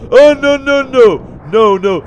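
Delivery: loud, high cry of alarm; real panic; panicked dismay. Real, not acted. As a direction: wail in alarm at the danger. Slime appears in the way.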